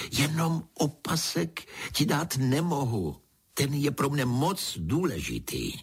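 high-pitched, lightly acted protest; exclaiming. A narrator's reading. King tells Pedro the belt matters, exclaiming. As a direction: plead to keep something precious. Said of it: "zvolal král".

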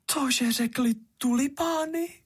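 flattered little hoe, pitch soaring to the end; proud delight. A narrator's reading. Hoe hears the tulips praised her, high and proudly delighted. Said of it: "byla na to velice pyšná".

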